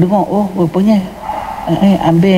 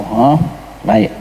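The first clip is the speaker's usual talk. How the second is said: ordinary talk, nothing marked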